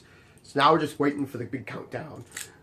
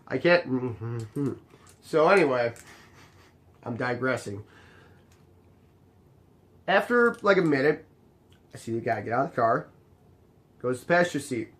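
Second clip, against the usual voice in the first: ordinary delivery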